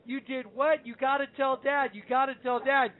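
high-pitched, acted alarm; mock freak-out. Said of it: "Zen and I freak out and say".

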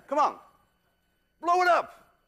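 high, loud cheering on; urging shout. Real, not acted. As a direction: egg the student on loudly. Coaching student to blow.

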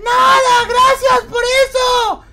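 high, slow sugary falsetto; woman voice. Pretend. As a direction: cover it up in a sweet lady voice. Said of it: "sé hacer voz de una perfecta mujer".

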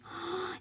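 quick, soft excited intake of breath; gleeful gasp. A narrator's reading. Little girl seeing the building, gleeful gasp. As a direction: gasp in delight. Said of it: "widening with glee".

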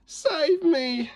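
very high, squeaky plea; small high cry for rescue; pleading for help. A narrator's reading. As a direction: beg like a tiny cartoon victim. Victim begs to be saved.

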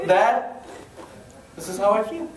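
lightly acted son, in a near-normal voice; son addressing dad. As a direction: tell your dad, only slightly in character. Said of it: "That would never work with my dad".

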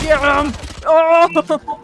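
yelling mid-fight, pumped up; excited shout. Real, not acted. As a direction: shout it out as the action hits. Shouting in a firefight.

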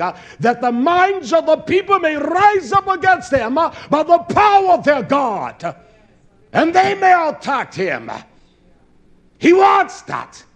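loud, high, rising sermon cry; thundering preacher; preaching at full force. Acted. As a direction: build the warning up to a shout.

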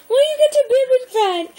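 high, loud laugh-talk; laughing while talking. Real, not acted. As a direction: talk through giggles.

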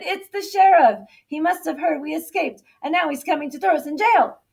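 loud, high-pitched storybook panic; alarmed shouting. A narrator's reading. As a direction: shout the bad news to the flock. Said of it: "Wing shouted".

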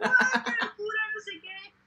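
stretched, slow, thrilled cry; excited squeal. Retold or imitated. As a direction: squeal like meeting a famous fan. Fan recognized at concert.

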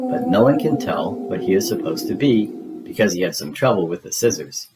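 usual speaking voice

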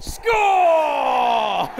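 loud, high victory yell; triumphant shout. Acted. Comedian cheering his imaginary win, triumphant shout. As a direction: celebrate like you scored a goal. Celebrating again.

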